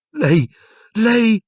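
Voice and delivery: high, loud, stammered outrage; shrieked accusation. A narrator's reading. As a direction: fling the word out high. Hermione shrieking accusation at Lupin.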